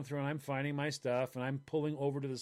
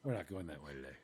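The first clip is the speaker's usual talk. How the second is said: put-on muttered old-lady order; 11 semitones lower, 12 dB quieter than usual. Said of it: "told them".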